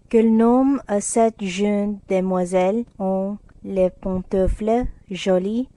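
measured, careful French phrase; good-natured French. A narrator's reading. Laurie speaks French for Jo on request, good-naturedly. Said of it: "said Laurie good-naturedly".

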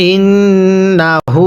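slow drawn-out tajweed chant; Quran recitation. Shown as a demonstration. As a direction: stretch every vowel like a teacher reciting. Recites the verse.

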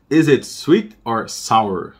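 slow model sentence. Shown as a demonstration. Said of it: "slow model read for students".

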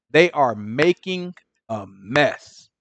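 they are making a mess said very slow and drawn out; slow emphasis. Acted. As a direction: drag every word of making a mess. Preacher repeats the phrase.

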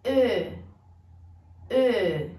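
E said with lips rounded as for O; French eu vowel. Shown as a demonstration. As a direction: close the mouth for O but say E. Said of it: "Então vamos tentar".

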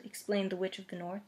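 plain reading voice

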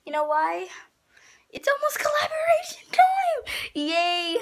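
high-pitched, thrilled vlog greeting; excited. Real, not acted. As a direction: squeal the big news to your viewers. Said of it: "I'm so excited right now".

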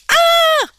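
very high, loud shriek of fright; terrified scream. A narrator's reading. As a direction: scream as the boy pops up. Boy jumps out, Smook screams.

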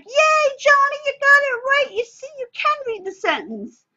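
very high, loud cheerleader praise; cheerleading. Shown as a demonstration. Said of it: "a lot of, you know, cheerleading".

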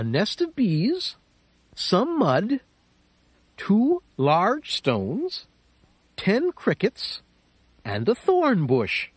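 a hint of a clever small mouse. Mouse voice held.

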